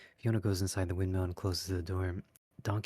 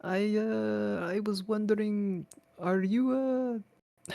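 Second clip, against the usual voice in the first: nervous, halting and much higher; bashful stammer; 14 semitones higher, 4 dB louder, much slower than usual. Acted. Shrek fumbling to tell Fiona his feelings, bashful. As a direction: stammer it out shyly. Shy ogre fumbling confession.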